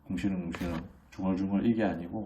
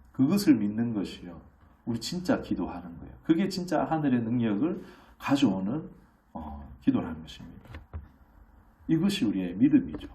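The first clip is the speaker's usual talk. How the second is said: usual speaking voice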